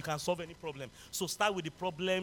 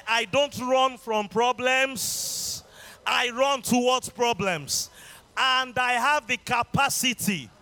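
slow emphatic declaration; 6 semitones higher, 9 dB louder, slower than usual. Acted.

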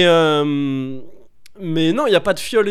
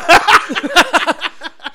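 a loud, high burst of laughter; 10 semitones higher, 6 dB louder than usual. Real, not acted. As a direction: crack up loudly.

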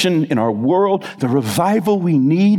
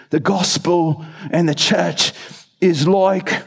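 bad Australian accent; 2 semitones higher, slower than usual. Preacher quoting an Australian church planting speaker, butchered Australian accent. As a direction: quote it in a clumsy Australian accent. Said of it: "I'm butchering the Australian accent".

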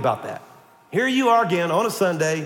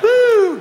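high, loud mock-spooky "woo"; 9 dB louder than usual. Mocking people freaked out by deliverance, spooky woo. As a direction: wail a sustained, high ghostly woo.

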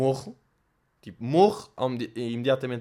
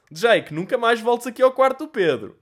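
Jake's dad promo; 7 semitones higher than usual. Pretend. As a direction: sell the show like an over-the-top radio dad. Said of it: "Eu sou o pai do Jake". (pai do Jake a fazer a promo, exagerado e aos gritos)

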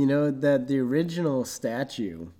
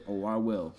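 low voice; 6 semitones lower, 8 dB quieter than usual. Retold or imitated. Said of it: "And he's like".

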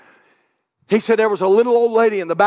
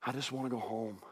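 much softer, lower, tired plea; weary hospital patient; 6 semitones lower, 8 dB quieter than usual. Retold or imitated. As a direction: voice a sick patient longing to go home. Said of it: "this is what they'll say".

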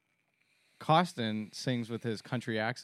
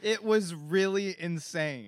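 drawn-out, higher and louder witness voice; mock witness; 7 semitones higher, 5 dB louder, slower than usual. Co-host mimics woman at the sinkhole, mock witness. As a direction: play an overwrought eyewitness, stretched out.

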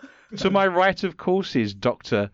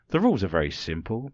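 usual speaking voice

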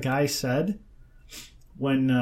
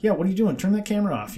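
imitated pushy demand, pitched higher; confrontational; 6 semitones higher, faster than usual. Man orders interviewer to stop filming, mock confrontational. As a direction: mimic someone challenging a cameraman. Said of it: "confrontational, yeah".

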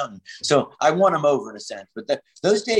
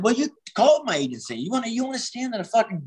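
indignant retort; 8 semitones higher than usual. Retold or imitated. Refusing angry promoter's breezeway gig.

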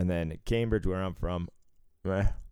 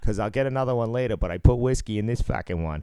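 cartoon Boston accent; 4 semitones higher than usual. Mocking how movies show Boston guys, cartoon Boston accent. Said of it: "make Boston out to be this fucking cartoon characters".

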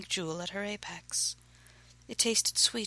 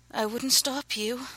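slow, raised, rasping reply; rasped; 6 semitones higher, 5 dB louder than usual. A narrator's reading. Woman answering her lover, rasped. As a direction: rasp it out slowly. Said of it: "she rasped".

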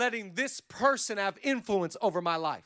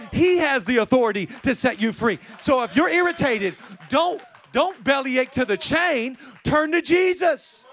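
loud, raised, punched declaration; full-force preaching; 3 semitones higher, 6 dB louder than usual. Real, not acted. Preacher urging congregation to turn to Jesus, full-force preaching. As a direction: drive each phrase home loudly.

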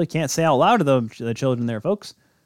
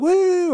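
high-pitched "woo"; whooping like fans on camera; imitated fan cheer.

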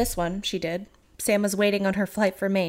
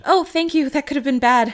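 higher, louder grateful reply; bright thanks; 6 semitones higher, 7 dB louder than usual. Pretend. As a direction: thank him brightly, a bit startled. Same line re-imagined.

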